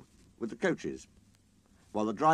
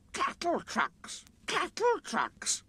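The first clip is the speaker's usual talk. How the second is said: slow, high grumbling chant; grumbling coaches; much slower than usual. A narrator's reading. The coaches grumbling about being called cattle trucks. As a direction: grumble it slowly like rolling wheels. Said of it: "grumbled the coaches".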